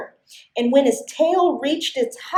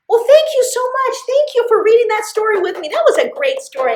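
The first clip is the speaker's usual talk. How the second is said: cheery thanks; 9 semitones higher, 4 dB louder, faster than usual. Real, not acted.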